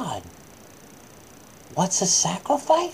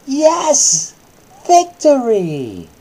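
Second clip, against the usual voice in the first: louder, drawn-out cheer of victory; triumphant; 7 semitones higher, 7 dB louder, much slower than usual. Acted. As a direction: celebrate the win slowly and proudly. Triumphant moment in story.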